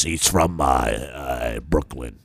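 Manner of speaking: low Brooklyn growl as the little driver; Brooklyn accent. Retold or imitated. Friend imitating the Brooklyn limo driver, in a Brooklyn accent. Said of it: "And he talks like this".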